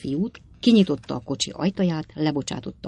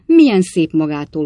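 louder effusive welcome; exclaimed greeting; 9 dB louder than usual. A narrator's reading. Ben Allen thanking his visiting aunt, exclaiming. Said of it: "kiáltotta Ben Allen úr".

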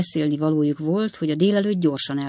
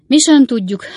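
loud, high-pitched reply; aloud; 8 semitones higher, 10 dB louder than usual. A narrator's reading. Friend answers an unspoken question, speaking out loudly. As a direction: speak up clearly, well above usual pitch. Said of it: "fennhangon válaszolva a néma kérdésre".

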